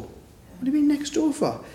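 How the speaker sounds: high, puzzled mother's question; mum's high question. Retold or imitated. Mum presses her daughter about next door, voice pitched high. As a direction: ask it high and wondering, like a mum.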